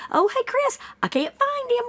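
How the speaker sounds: high, light, unbothered; mock breezy tone. Mocking the father's calm report to a friend, breezy. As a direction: say it like small talk. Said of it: "You would not say it like that".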